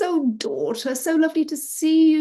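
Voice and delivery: high, loud, hearty welcome; excited father. A narrator's reading. Father greeting his daughter, excited. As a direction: gush loudly over a long-missed child. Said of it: "they're really excited to see each other".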